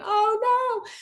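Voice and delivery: high, loud cry for help, hammed up; mock panicked scream. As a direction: scream for rescue in playful, exaggerated panic. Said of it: "I used to scream".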